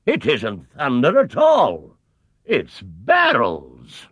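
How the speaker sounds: booming Sir Huntley. A narrator's reading. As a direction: announce it like an alarmed chairman. Sir Huntley realising barrels.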